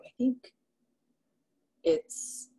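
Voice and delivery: ordinary talk, nothing marked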